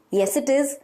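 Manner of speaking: normal talking voice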